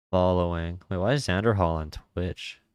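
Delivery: normal talking voice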